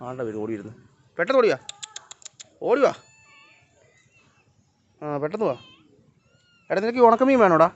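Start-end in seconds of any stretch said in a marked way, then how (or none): 1.2-5.6 calling a pet. Real, not acted. Calling the cat over. 6.7-7.7 high, loud coaxing call; coaxing call to a pet. Real, not acted. Offering dried fish to the cat.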